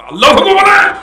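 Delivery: high ringing cry of Allahu Akbar; takbir shout. Real, not acted. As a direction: throw the takbir out full voice.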